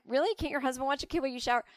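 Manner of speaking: tossed-off clueless sarcasm; snarky reply. Retold or imitated. Answering busy moms before she understood, a fast snarky retort. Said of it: "I'd be like".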